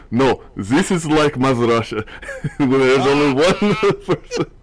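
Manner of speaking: put-on laughing through a Russian accent. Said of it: "now I am also speaking in a Russian accent".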